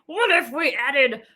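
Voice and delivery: lightly voiced pondering inventor, slow on the last words; a hint of a thoughtful old doctor wondering aloud; mild mock scientist voice, drawn out at the end; Doctor Kellogg musing. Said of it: "That's probably how he sounded".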